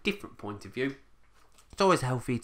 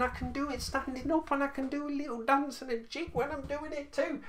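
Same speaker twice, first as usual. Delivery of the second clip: much higher, light singsong; playful high voice; 14 semitones higher, 4 dB quieter than usual. Acted. Vlogger showing off his new monitor stands, playful high voice. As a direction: bounce it up like you're dancing.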